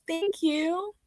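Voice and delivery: ordinary talk, nothing marked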